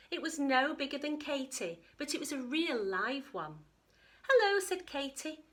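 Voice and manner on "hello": high, loud surprised hello; surprised greeting. Acted. Katie greeting the little dinosaur, surprised. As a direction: call out in delighted surprise.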